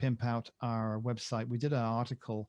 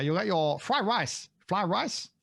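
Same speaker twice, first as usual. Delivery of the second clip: slow, higher put-on Chinese accent; 6 semitones higher, slower than usual. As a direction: attempt a Chinese accent on the order. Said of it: "I can't do the Chinese accent".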